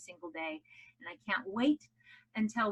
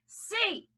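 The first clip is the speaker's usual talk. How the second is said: "C" pitched high and held; high, stretched stress on "C"; accented letter; 9 semitones higher than usual. Shown as a demonstration. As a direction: lift "C" well up with an open mouth. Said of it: "accent every other letter".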